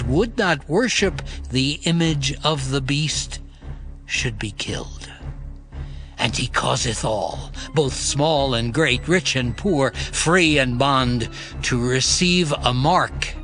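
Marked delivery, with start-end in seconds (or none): none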